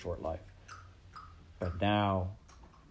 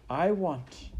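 higher, halting start; serving girl building up; 8 semitones higher, 4 dB louder than usual. Acted. Maid about to name her wish, high hesitation. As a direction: begin the wish and hang on it.

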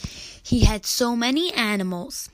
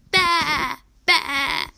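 high, loud, wavering baa; sheep bleating; 5 semitones higher, 6 dB louder than usual. A narrator's reading. Sheep answer Mary, high loud bleat. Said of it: "Few of the sheep also said".

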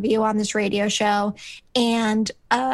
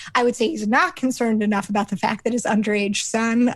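ordinary talk, nothing marked

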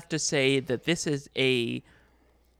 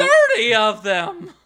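lady voice; 6 semitones higher, 9 dB louder, slower than usual. Pretend. Showing off put-on voice.

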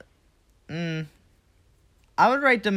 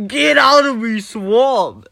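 loud, high ogre bellow; Shrek impression; 5 semitones higher, 9 dB louder, slower than usual. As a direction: bark it like an angry ogre. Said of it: "She turned into freaking Shrek".

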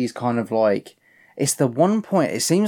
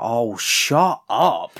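drawn-out mock exasperation; exasperated retort; much slower than usual. Imagined reply to someone who always talks dramatically, exasperated. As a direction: stretch it out, fed up with them.

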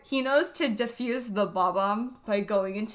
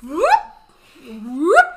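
whoosh sound effect; 9 dB louder than usual. Retold or imitated. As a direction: mimic the ship zooming in, rising. Imitating doom ship zooming.

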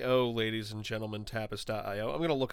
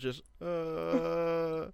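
long, droning drawn-out groan; Tina from Bob's Burgers; 4 semitones higher, 3 dB quieter than usual. Retold or imitated. Co-host imitates a cartoon character, a long monotone groan. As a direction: hold a higher "uh" on one note. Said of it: "Tina from, from Bob's Burgers".

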